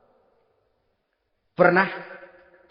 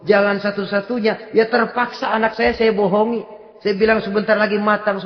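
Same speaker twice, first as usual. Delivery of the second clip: ordinary talk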